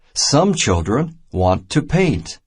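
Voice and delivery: plain reading voice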